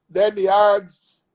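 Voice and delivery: normal talking voice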